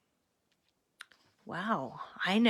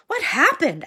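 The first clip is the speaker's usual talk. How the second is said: confused question; 9 semitones higher, 6 dB louder than usual. Acted. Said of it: "asked another man".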